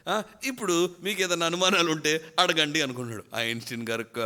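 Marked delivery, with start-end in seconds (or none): 0.1-2.8 mimicking Einstein. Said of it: "నార్మల్గా సహజంగా ఏమంటాడంటే".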